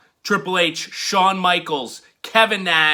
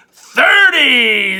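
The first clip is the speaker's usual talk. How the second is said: louder shout of the number; big announcement; 7 semitones higher, 8 dB louder than usual. Acted. Host revealing the brother rating, shouted announcement. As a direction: announce it like a score.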